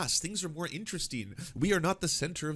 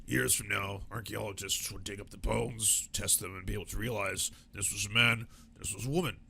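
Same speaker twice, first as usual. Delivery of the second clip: mock right-wing pundit; 5 semitones lower than usual. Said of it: "all of those people who use the example of".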